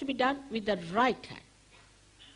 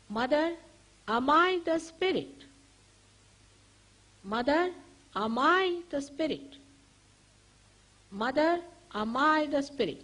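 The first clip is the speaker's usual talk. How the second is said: higher, louder, earnest plea to Mother; sincere question; 6 semitones higher, 4 dB louder than usual. Shown as a demonstration. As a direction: ask it from the heart, lifting the voice. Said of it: "Please ask me sincerely". (a sincere question, a little higher and louder)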